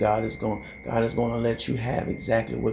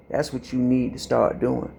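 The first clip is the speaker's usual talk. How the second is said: ordinary talk, nothing marked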